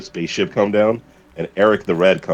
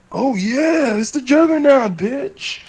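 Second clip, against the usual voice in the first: much higher, strained cartoon brute voice; Juggernaut voice; slower than usual. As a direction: belt it out like a cartoon villain bragging. Retelling a funny dub video.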